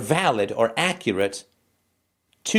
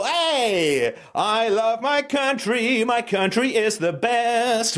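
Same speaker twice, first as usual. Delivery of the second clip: put-on high, slow, sing-song patriotic kid; brainwashed child; 7 semitones higher, slower than usual. Host playing a schoolchild reciting national pride, high and slow. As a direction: recite it like a proud, indoctrinated child. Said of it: "the mind of a child".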